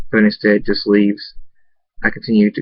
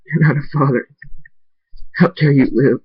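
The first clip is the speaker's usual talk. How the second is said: higher, anguished self-blame in tears; tearful self-reproach; 5 semitones higher than usual. A narrator's reading. Crying father alone blaming himself for failing his son, tearful self-reproach. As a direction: berate yourself through tears. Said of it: "out of anger as I cried more".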